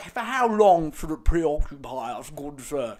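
lightly voiced pompous toff; mock posh journalist. Sending up the journalist's fancy question, mock posh. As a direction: ham up the fancy phrasing like a stuffy gentleman. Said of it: "Don't be like".

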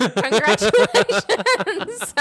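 high, loud and drawn out slowly; excited congratulations. Real, not acted. Celebrating her cohost getting over the breakup, loud and elated. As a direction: stretch it into a big cheer.

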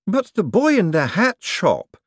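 worried protest to the master; anxious secretary. Acted. Secretary objecting, voiced high.

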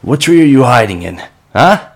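loud, high call upward; loud questioning call. Acted. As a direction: shout into the trees. Calling up to hidden voice.

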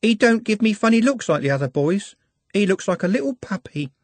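put-on high, girlish voice, lovestruck; high girlish voice, dreamy; dreamy young girl. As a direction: sound like a smitten young shopgirl. Juliet's voice.

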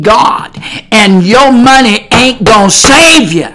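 loud, insistent pulpit preaching; forceful preaching. Real, not acted.

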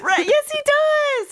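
high, loud, drawn-out comeback; mock indignant protest. Real, not acted. Friend insists that Remy does talk, mock indignant. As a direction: argue back slowly at the top of the voice. Playful argument about Remy talking.

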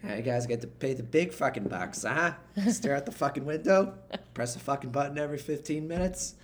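Boston captain ribbing the crew; gruff Boston captain. Retold or imitated. Captain needling his crew in a light Boston accent. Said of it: "your captain who is from Boston".

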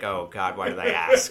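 dry, weary deadpan. Acted. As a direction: say it dead flat, half-amused. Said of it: "and deadpans".

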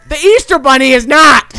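high, loud, laughing insistence; emphatic declaration. Real, not acted. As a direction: declare it with amused certainty.

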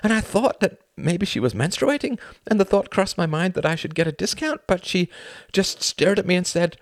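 high-pitched, agitated storytelling; distraught confession. Acted. Upset man recounting his night to a friend, distraught.

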